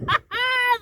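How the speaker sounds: a high, loud witch's cackle; wild fierce laugh. A narrator's reading. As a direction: let out a wild triumphant cackle. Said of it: "gave a wild, fierce laugh".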